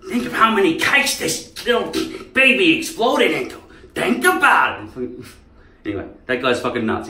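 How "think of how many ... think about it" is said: high, loud menacing mock villain; movie villain impression. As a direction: hiss threats like the crazy guy. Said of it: "He's like".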